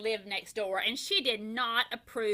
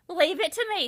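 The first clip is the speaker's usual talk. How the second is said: higher, louder and keen; eager offer; 7 semitones higher, 5 dB louder, faster than usual. A narrator's reading. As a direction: jump in to help. Eager sister volunteers.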